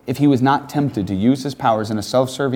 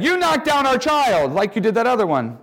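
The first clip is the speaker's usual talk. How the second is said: accusing parents; 10 semitones higher than usual. Retold or imitated. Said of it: "And they said".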